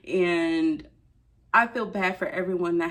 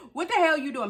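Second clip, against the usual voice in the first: indignant; 9 semitones higher than usual. Real, not acted. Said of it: "I just had to say it like that".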